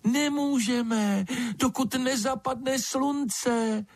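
very high, slow creature squeak; thin as a baby bird's cheep, with a cutting edge; drawn-out high piping voice; thin little voice. A narrator's reading. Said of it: "hlásek tenký jako pípot ptačího mláděte".

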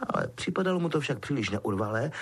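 plain reading voice

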